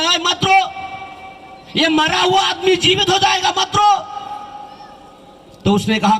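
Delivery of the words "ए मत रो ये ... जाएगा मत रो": sadhu's call. Retold or imitated. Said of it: "तो उसने बोला".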